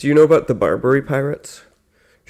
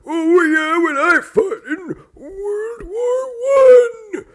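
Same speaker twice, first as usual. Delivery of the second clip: put-on very high, slow wavering old-timer; older veteran; slower than usual. Second veteran in the joke, high slow voice. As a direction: drag it out in a hammed-up high voice. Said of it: "the next one's like".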